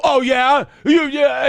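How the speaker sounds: loud, high challenge; mock an indignant comeback, spoiling for a fight; combative woman imitation. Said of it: "And women go".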